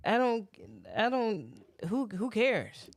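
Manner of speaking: normal talking voice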